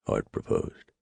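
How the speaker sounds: plain reading voice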